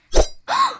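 high sharp shocked gasp. Acted. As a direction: gasp as the cloth tears. Gown ripped in fight.

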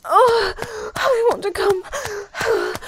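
breathless moans building up, voice cracking; moaning. Acted. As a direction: let the moans climb, gasping between words.

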